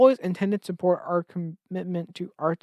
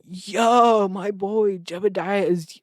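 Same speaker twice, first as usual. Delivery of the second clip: hyped bro; 3 semitones higher, 6 dB louder, much slower than usual. Acted. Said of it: "Colin going".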